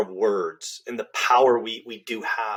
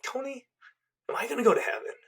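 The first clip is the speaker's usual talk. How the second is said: higher, softer, frightened question from a dying father; frail dying father; 12 semitones higher, 6 dB quieter, faster than usual. Retold or imitated. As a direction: ask it like a sick old man. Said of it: "and he's like".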